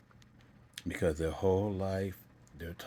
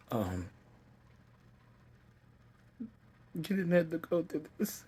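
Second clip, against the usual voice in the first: high, loud, tearful retelling; overcome with emotion; 7 semitones higher, 4 dB louder than usual. Real, not acted. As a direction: voice climbing, halting between phrases with real emotion. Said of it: "I feel that feeling again".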